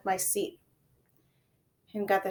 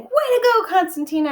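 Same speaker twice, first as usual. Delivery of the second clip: cheering; 13 semitones higher, 10 dB louder than usual. A narrator's reading. Kids cheering winner.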